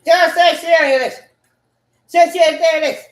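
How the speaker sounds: high, loud yell; shouted outburst. Real, not acted. Speaker bursting out with the stock phrase, shouting.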